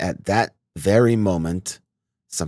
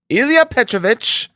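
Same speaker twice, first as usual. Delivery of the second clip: a light higher voice, pleading; anxious clerk; 6 dB louder than usual. A narrator's reading. Head clerk anxiously interrupting his superior. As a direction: cut in nervously.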